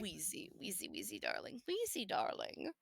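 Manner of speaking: whispered, tender cooing of a pet name; whispering. Acted. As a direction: coo the name softly, barely voiced. Cooing at a friend.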